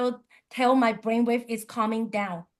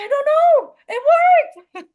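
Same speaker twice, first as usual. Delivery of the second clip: much higher and louder, gleeful disbelief; excited squeal; 14 semitones higher, 8 dB louder, slower than usual. Retold or imitated. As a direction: squeal it out to your friend. Answering Ian after car starts.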